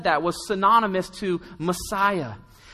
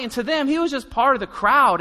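ordinary delivery

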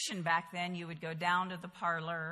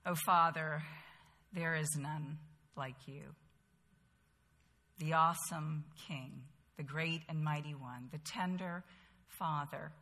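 softly spoken prayer; 3 semitones lower, 4 dB quieter than usual. Real, not acted. Women's group leader opening in prayer, quiet and reverent. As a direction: speak to God gently and sincerely.

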